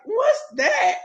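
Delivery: sudden high surprised question. Retold or imitated. Girlfriend spotting the gift box, high surprised ask. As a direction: react as if a box just appeared in his hand. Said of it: "And so I'm like".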